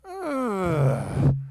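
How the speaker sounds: long falling "ugh"; disgusted groan. Acted. Listener recoiling from Jesus' hard claim, disgusted groan. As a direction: groan in recoil, sliding downwards.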